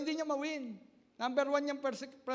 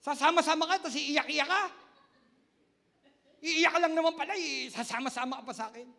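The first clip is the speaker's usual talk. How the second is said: loud, high taunting husband; mocking scold; 4 semitones higher, 7 dB louder than usual. Husband taunting his crying wife, loud mock scolding. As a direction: scold her for crying at church. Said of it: "sabi daw ng asawa niya".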